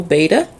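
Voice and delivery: "betta" said as BAY-tuh; habitual mispronunciation. Real, not acted. Fishkeeper naming the fish by habit, "betta" as BAY-tuh. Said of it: "I keep saying beta".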